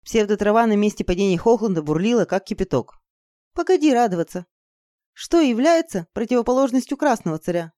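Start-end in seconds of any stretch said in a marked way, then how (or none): none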